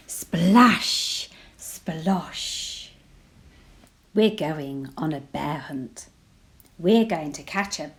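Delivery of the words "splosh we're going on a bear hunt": ordinary delivery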